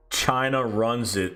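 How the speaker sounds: ordinary talk, nothing marked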